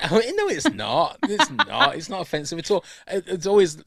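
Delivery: high, loud denial through laughter; laughing protest. Real, not acted. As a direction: protest loudly while laughing. Laughing protest at accent remark.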